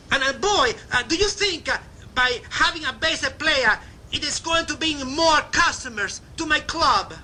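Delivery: high, fast imitation of an Italian club owner's accent; playful Italian-accented refusal; cute Italian accent. Club owner Mister Finocchio refusing Laverne's bass player, in a cute Italian accent. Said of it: "cute Italian accent".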